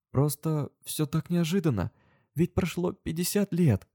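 high, slow, plaintive voice; slow, high lament; tearful explanation. A narrator's reading. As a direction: explain it plaintively, drawing words out. Tearful heroine explains herself.

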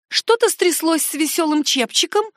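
high-pitched worried woman; alarmed question. A narrator's reading. Cook anxiously asks about the ship. (высокий встревоженный вопрос)

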